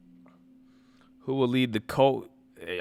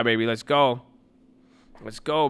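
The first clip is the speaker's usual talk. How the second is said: usual speaking voice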